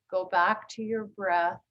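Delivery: ordinary delivery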